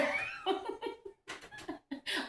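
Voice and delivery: high shrieking laugh that falls away; burst of laughter. Real, not acted. Laughing at the memory of the rude comment. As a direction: cracks up helplessly.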